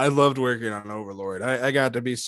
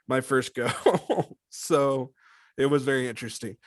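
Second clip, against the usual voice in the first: usual speaking voice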